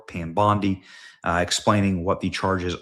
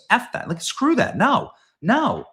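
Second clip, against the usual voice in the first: much higher, loud scornful dismissal; indignant outburst; 14 semitones higher, 3 dB louder than usual. Real, not acted. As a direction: spit it out like you won't stand for it. Angry rant against weakness.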